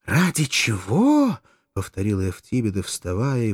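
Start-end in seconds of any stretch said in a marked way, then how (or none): 0.1-1.4 higher, slow, drawn-out exclamation; slow, rising incredulous cry; outraged echo. A narrator's reading. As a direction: throw the question back indignantly. She repeats it, outraged and louder.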